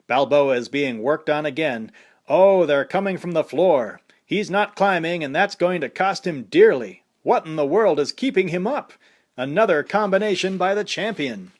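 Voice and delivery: boxing announcer calling the action; fight commentator. A narrator's reading.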